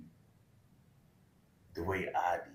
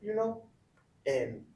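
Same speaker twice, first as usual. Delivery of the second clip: ordinary delivery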